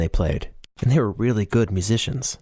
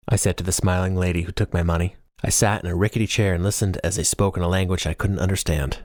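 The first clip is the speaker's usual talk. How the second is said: plain reading voice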